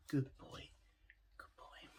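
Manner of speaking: soft praise fading to a whisper; soothing praise. Real, not acted. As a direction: hush a puppy with quiet praise. Praising the settling puppy.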